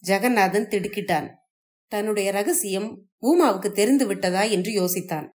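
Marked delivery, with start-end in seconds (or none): none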